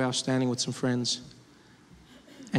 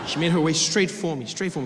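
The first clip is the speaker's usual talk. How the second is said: ordinary talk, nothing marked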